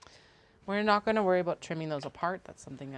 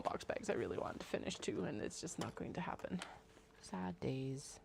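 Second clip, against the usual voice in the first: whispering; 9 dB quieter than usual. Real, not acted. Mock mourning unfinished bags.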